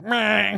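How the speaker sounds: high nonsense bleat; silly "meh" noise. Pretend. Host teasing co-host's sore head, silly "meh". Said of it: "Does your head feel like".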